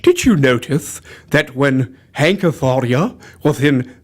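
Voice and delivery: low, lisping monster-movie actor; Karloff. Acted. Explaining a movie voice, lisping horror-star delivery. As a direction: intone it like an old horror star. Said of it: "He did the Karloff voice".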